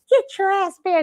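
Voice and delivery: high, insistent mock order; bossy play brother. Play brother calling her at night, mock bossy order.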